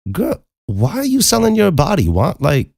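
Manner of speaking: high-pitched incredulous pleading; exasperated disbelief. Retold or imitated. Teller re-enacts confronting the woman, exasperated and incredulous. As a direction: lift the pitch and demand an answer.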